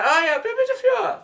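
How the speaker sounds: very high, loud mimicked squeal; mock passionate cry. Mimicking what the man's girlfriend called.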